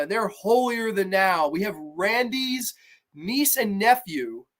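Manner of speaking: ordinary delivery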